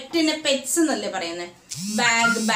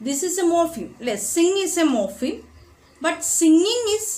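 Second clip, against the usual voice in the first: higher, louder, slow emphasis on the point; emphatic teaching; 7 semitones higher, 4 dB louder, much slower than usual. Real, not acted. Teacher stressing to students that sing is a morpheme, emphatic. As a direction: drive the point home to the class.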